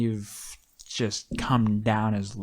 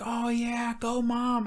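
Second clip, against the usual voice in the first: mock cheer; 12 semitones higher than usual.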